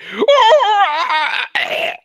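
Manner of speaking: long, high, loud howl of pain; mock death scream from a broken back; acted agonized scream. Game master voices the monkey with its back broken, hammed-up high scream. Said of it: "he's like".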